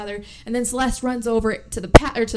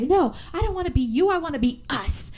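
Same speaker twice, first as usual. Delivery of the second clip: obsessive; 6 semitones higher than usual. Retold or imitated. Twin's obsessive retort.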